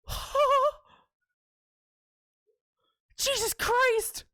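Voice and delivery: high, drawn-out shocked exclamation; shocked outcry. Acted. Player watches the dog's rampage, shocked. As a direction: exclaim in high-pitched horror. Reacts to dog eating hot dogs.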